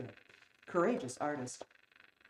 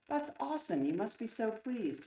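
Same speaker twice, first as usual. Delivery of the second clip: high-pitched congratulation; delighted reply; 7 semitones higher than usual. A narrator's reading. Partner congratulated on news from the press, delighted. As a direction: lift the voice in delight.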